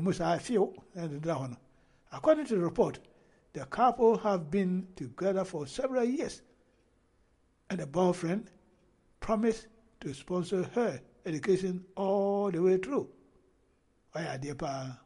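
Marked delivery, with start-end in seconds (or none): 2.1-13.1 raised, emphatic report reading; news read-aloud. Acted. Vlogger reading the couple's report to viewers, emphatic news read-aloud. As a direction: read it out phrase by phrase with deliberate weight.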